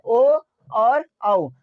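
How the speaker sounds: ओ and औ stretched very slowly, each vowel held long; drawn-out vowel demo. Shown as a demonstration. Teacher to class, showing how the vowels sound, very slow. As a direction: draw out ओ and औ so students feel the lips round. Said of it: "ओ बोलते हैं हम लोग".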